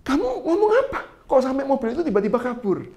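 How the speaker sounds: high, startled asking; surprised question. Retold or imitated. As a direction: ask in sudden disbelief. Pendeta heran, bertanya pada jemaat. (bertanya kaget dengan suara tinggi)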